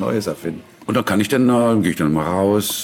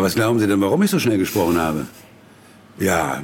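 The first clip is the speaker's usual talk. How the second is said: ordinary talk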